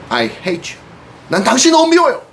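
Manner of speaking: angry defiance. Acted. Slave girl spits at buyer.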